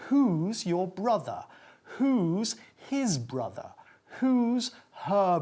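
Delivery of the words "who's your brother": normal talking voice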